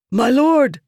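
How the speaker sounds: desperate high shout; crying out. A narrator's reading. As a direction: cry out to your lord, wounded. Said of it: "the man cried".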